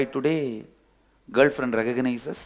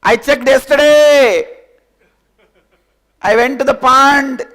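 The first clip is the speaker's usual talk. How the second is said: calling out; 10 semitones higher, 12 dB louder than usual. Acted. Lion shouts his news to the sheep. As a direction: call it out across the distance.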